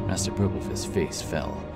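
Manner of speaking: plain reading voice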